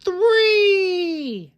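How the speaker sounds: very high, loud call-out; excited answer. A narrator's reading. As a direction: shout the answer to the kids. Counting buttons left.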